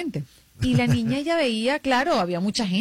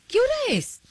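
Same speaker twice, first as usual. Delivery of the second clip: put-on confused sleepy girl; 13 semitones higher than usual. Sleepy sister wakes confused.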